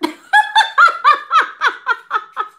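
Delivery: shrieking burst of laughter; loud high laugh. Real, not acted. Reader cracking up at her own joke, loud high laugh.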